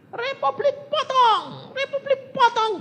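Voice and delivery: high, loud, drawn-out chant; mob war cry. Retold or imitated. Retelling how an Irian mob threatened him, high loud war cry. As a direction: imitate a mob shouting its threat. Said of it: "Demikian saya teriak gitu".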